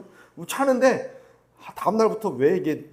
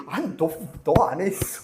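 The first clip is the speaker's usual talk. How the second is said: amused reply; 2 semitones higher, 4 dB louder than usual. Pretend.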